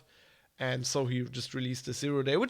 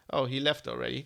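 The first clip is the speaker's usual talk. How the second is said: ordinary delivery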